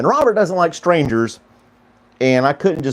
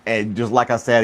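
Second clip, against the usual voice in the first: ordinary talk, nothing marked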